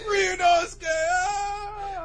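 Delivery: very high, loud, drawn-out howl; over-the-top anime grief, trailing into "ah"; mock mourning. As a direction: wail the name to the sunset. Acted cry at sunset image.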